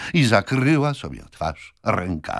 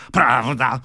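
emphatic contradiction; 5 semitones higher, 6 dB louder than usual. A narrator's reading. Brother flatly contradicts his sister.

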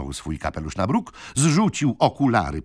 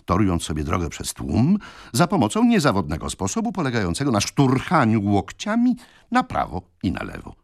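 ordinary delivery, nothing marked

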